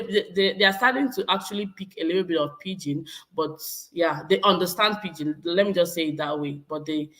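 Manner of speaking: ordinary talk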